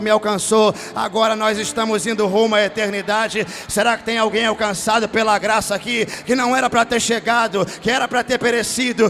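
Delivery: loud, high, half-sung revival preaching, shouting the climaxes; preaching at full force. Acted. Pastor to his congregation about being reached by grace, roaring sung preaching. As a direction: lift the church up and bellow the promise of grace.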